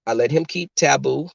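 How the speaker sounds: ordinary talk, nothing marked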